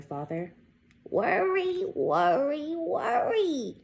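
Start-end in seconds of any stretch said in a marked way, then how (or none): 1.1-3.8 higher, sing-song grandmother; a fussing grandma repeating it. Acted. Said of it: "said her grandmother".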